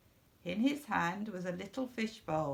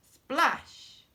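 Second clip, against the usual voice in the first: splash sound; 5 semitones higher, 7 dB louder than usual. A narrator's reading. Sound word in story.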